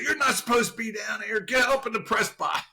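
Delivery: high-pitched mock yell; retold scolding. Ernie sending an intruder to the press box, mock scolding. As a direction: imitate an official ordering someone out.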